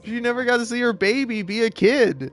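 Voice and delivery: high-pitched, hammed-up tearful whine; mock crying. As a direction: play up being on the verge of tears. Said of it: "I'm fucking sitting here crying".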